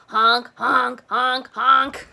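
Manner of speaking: loud honking like a goose; honk, honk, loud and bright. Shown as a demonstration. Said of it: "Can you honk like a goose?".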